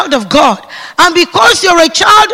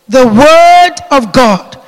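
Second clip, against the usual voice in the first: slow emphatic response; 7 dB louder, slower than usual. Real, not acted. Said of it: "They're responding".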